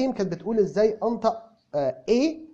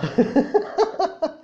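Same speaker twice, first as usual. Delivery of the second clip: real laugh; 11 semitones higher, 5 dB louder than usual. Real, not acted. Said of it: "منك لله عبد الله".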